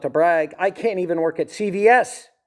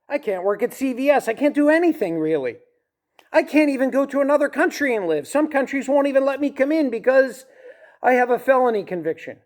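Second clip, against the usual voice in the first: higher, exasperated insistence; exasperated venting; 7 semitones higher than usual. Real, not acted. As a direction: let the exasperation climb. Venting about life as a felon.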